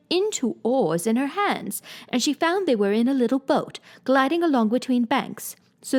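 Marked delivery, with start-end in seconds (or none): none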